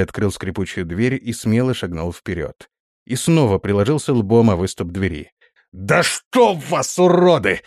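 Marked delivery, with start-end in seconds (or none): 5.7-7.6 high, slow drawn-out swearing; angry cursing. A narrator's reading. Prince hits his head a second time, cursing furiously. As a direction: curse through pain, exploding on the last word. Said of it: "Выругался он".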